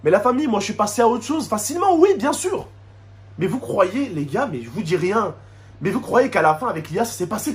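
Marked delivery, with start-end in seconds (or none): none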